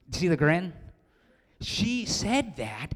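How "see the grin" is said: normal talking voice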